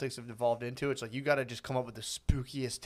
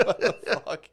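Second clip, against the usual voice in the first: laughing outburst; 5 semitones higher, 5 dB louder, slower than usual. Real, not acted.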